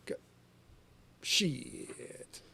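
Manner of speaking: usual speaking voice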